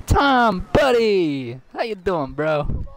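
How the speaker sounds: high, loud fake friendly; mock friendly greeting; mock cheerful greeting. Mock greeting of enemy Tom.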